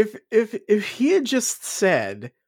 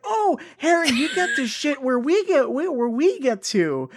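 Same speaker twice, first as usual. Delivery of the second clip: higher, gushing mock schoolgirl with a laugh; mock Hermione gushing; 8 semitones higher than usual. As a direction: gush it in a mocking, higher voice. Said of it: "Hermione being like".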